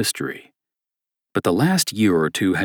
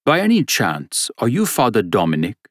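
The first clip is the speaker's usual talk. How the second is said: put-on hopeful German-accented question, rising high; thick German accent; 3 semitones higher than usual. German stranger asking priest his name, thick German accent. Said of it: "said a man with a thick German accent".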